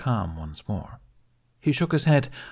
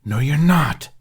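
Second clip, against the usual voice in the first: sharp, higher dragon hiss; 7 semitones higher, 8 dB louder, slower than usual. Acted. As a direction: hiss it back in agitation. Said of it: "Toothless hissed".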